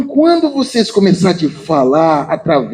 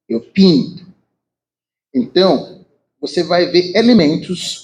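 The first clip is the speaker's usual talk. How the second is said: ordinary talk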